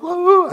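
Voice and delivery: loud, high burst of a sob; bawling cry. Retold or imitated. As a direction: let out one wailing cry. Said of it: "I just start bawling".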